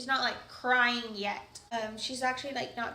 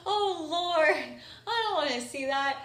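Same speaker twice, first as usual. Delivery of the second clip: mock disgust; 3 semitones higher, 4 dB louder than usual. Mother recoils from the placenta.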